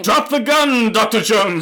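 high, loud mock villain shout; hammed-up movie villain yell; shouted villain line. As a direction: bark it like the Nazi traitor.

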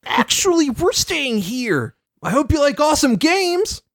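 high, playful trickster voice; sing-song mischievous villain; Discord voice. Retold or imitated. Acting out Discord's lines.

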